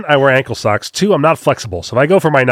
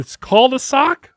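mock disbelief; 11 semitones higher, slower than usual. Incredulous at the sock knife.

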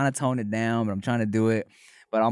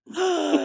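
surprised gasp. Retold or imitated. Shocked at hearing British.

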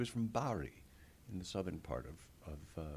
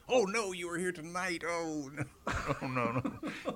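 hammed-up actor; 11 semitones higher, 5 dB louder than usual. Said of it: "he does the whole".

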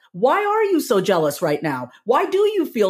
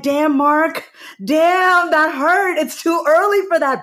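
mock stung reaction; 9 semitones higher, 5 dB louder, slower than usual. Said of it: "I'm like".

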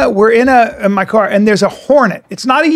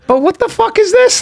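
much higher, baffled mock outrage; incredulous protest; 11 semitones higher than usual. Son retells objecting to mom about dad, incredulous protest. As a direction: retell it in high-pitched disbelief.

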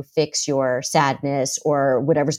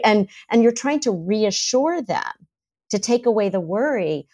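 ordinary delivery

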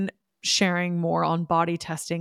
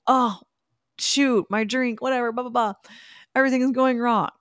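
mock dismay; 6 semitones higher, 3 dB louder than usual. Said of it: "been like".

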